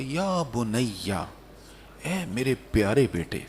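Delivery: tender and low, drawing out the endearment; very loving. Acted. Father lovingly addresses his dear son, very tenderly. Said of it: "بڑے پیار سے کہا".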